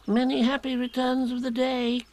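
high, tiny squeaky voice; Piglet. A narrator's reading. Little Piglet wishes Eeyore happy birthday, high squeaky voice. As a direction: pipe it up on a high, level note. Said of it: "said Piglet".

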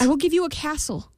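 high-pitched, pleading queen; desperate bargaining. A narrator's reading. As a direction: offer it urgently to the imp. Queen bargains desperately for her baby.

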